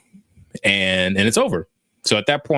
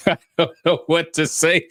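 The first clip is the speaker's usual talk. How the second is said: higher, laughing through the words; 8 semitones higher than usual. Real, not acted. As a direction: tell it through helpless chuckles. Laughs at own tongue-tied moment.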